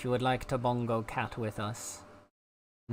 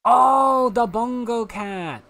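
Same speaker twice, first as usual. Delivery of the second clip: high, loud, slow "oh, the bongo cat"; loudly drawn-out aha; loud realisation; 11 semitones higher, 13 dB louder, much slower than usual. Real, not acted.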